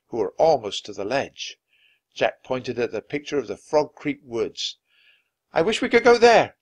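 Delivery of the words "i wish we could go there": high, loud urgent wish; shout. A narrator's reading. Jack wishing them home as Vikings near, shouting. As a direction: shout it out in a hurry. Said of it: "Jack shouted".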